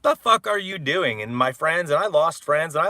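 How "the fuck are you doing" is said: loud, much higher mock yell; a shouted retold outburst; quoting dad yelling. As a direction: blurt it out in disbelief. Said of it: "everybody was saying".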